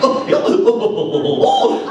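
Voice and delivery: high, loud cartoon guffaw; Goofy laugh. Shown as a demonstration. The voice actor tops off his Goofy greeting with the laugh. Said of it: "I do the voice of Goofy".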